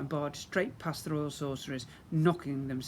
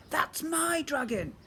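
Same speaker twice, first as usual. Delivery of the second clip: bellowed; 12 semitones higher, slower than usual. Acted. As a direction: bellow in troll rage. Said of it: "I bellowed".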